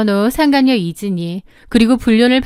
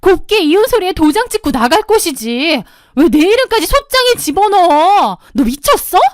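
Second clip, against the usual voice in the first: higher, louder screaming rage; furious yelling; 6 semitones higher, 3 dB louder than usual. A narrator's reading. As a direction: explode at her for filing the suit. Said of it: "다짜고짜 고함을 질렀습니다". (소송에 눈이 뒤집혀 고함치는 시누이)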